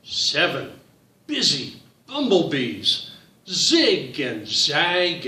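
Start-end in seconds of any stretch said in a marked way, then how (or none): none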